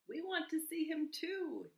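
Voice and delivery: high, loud storybook dragonflies; excited little creatures joining in; eager chiming in. A narrator's reading. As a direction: pipe up eagerly, pitched well up. Dragonflies eagerly join the onlookers.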